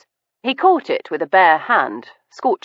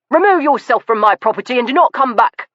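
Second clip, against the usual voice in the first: higher, fast, loud command; angry order; 7 semitones higher, 4 dB louder, faster than usual. Acted. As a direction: order him off the property. Master ordering Stoker out.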